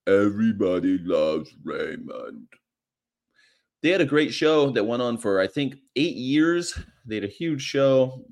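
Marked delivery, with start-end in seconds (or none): 0.1-2.5 lower, slow deadpan line; a hint of Brad Garrett's low drone. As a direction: drop low and drag the title out. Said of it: "Brad Garrett goes like".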